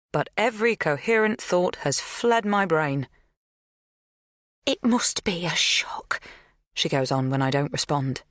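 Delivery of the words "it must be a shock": whispered. A narrator's reading. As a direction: hush it, slowly. Magda's gentle revelation.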